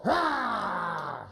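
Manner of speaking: open-throated "rah" like a pouncing wolf; wolf roar. Shown as a demonstration. Coach having fun showing the child wolf, roaring. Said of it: "make that voice for me".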